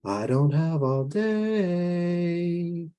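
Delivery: slow, high, sung-out lilt; sing-song. Acted. Strange visitor hurrying a mother at the door, sing-song. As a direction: stretch it out and hold the last note. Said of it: "it sang again".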